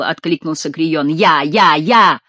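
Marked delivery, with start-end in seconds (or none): 1.1-2.2 loud, arrogant yell; defiant shout. A narrator's reading. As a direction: taunt them defiantly. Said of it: "выкрикнул ... с самой вызывающей надменностью". (вызывающий выкрик)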